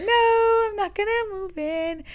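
high, slow put-on voice; exaggerated high reply, drawn out; high-voiced refusal. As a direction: pitch it way up and stretch the refusal. Mocking reply to deep-voiced client.

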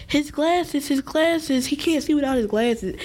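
high, pleading quote; crying out for his glasses; distressed mother. Retold or imitated. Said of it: "She was like".

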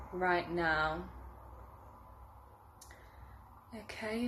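unhurried meditation guide, growing hushed toward the end; guided meditation. Acted. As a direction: lead them gently through a visualization, softening as it deepens. Leads a guided visualization.

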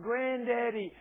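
high excited call of Granddaddy; child's holler. Retold or imitated. Grandson calling to grandfather as he walks in, excited holler. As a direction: call out like a toddler greeting. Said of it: "and he hollered".

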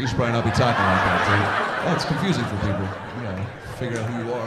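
rattled off fast through laughter; fast laughing reply. Retold or imitated. Comic telling the heckler off, fast and laughing. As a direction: lecture him quickly, half laughing. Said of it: "and I was like".

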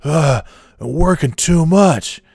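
slow, high, groaning complaint; loud put-upon whine; woe-is-me whine. Retold or imitated. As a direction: imitate a self-pitying coworker. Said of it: "Oh, woe is me".

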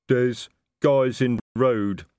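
troll voice. Acted.

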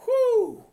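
high joyful whoo shouted; exultant whoop. Real, not acted. Preacher to congregation, joyful whoop. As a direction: let out a whoop of praise.

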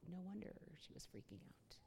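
hushed, unvoiced realization; whispered aside. Realizing why the nurse panicked, whispered aside. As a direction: breathe it out as a secret aside.

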